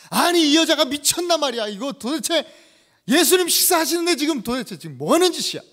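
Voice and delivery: acted loud, high outburst of a disciple; angry outburst. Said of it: "어떤 한 제자가 화를 버럭 냅니다". (버럭 화내는 제자 연기)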